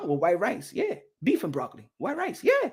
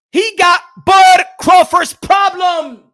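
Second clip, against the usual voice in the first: slow, loud, high shout; furious shout; 11 semitones higher, 15 dB louder, much slower than usual. Real, not acted. Ranting at fight fans, a furious slow shout. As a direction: bellow it to the whole room. Boxing rant climax.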